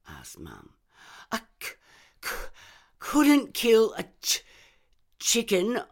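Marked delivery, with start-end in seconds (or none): 1.3-5.8 high, loud broken stammer. Acted. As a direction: stammer every word. Reb stammers.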